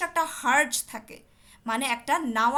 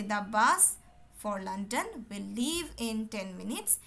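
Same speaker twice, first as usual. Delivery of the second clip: very slow, dragged reading; too slow; 5 semitones lower, much slower than usual. Shown as a demonstration. Teacher showing students a too slow reading. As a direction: drag every word out. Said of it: "আপনি যদি আস্তে আস্তে কথা বলেন".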